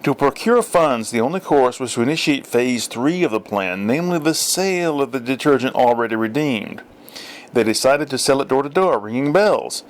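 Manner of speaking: ordinary delivery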